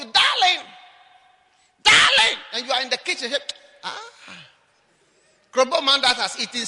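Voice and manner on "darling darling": high loud call of darling; husband calling out. Retold or imitated. Husband calling his wife from the table, loud call. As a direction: call out for the wife across the house. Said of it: "Then he calls for you".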